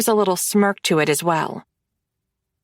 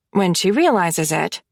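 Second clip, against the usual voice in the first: plain reading voice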